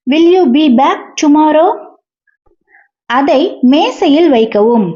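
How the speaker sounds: ordinary delivery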